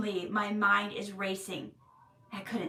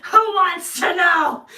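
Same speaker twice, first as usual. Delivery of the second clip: loud, slow, higher gruff snap; angry retort; 7 semitones higher, 12 dB louder, slower than usual. Retold or imitated. Injured woman snapping at the stranger, loud angry retort. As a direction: bark it back at the stranger. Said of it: "She's all, like, angry".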